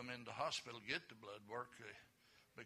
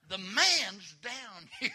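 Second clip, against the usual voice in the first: loud, high, drawn-out holler; hammed-up shout for help; calling out; 9 semitones higher, 13 dB louder, much slower than usual. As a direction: holler it high and slow, stretching the words. Calls out where the man is.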